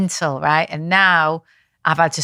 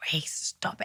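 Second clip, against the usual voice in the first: sharp whisper, much softer; 14 dB quieter than usual. Retold or imitated. Mum telling Grace to stop, whispered. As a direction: hush it urgently through the teeth. Mother hissing at tantrum child.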